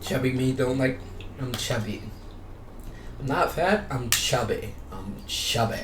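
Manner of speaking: normal talking voice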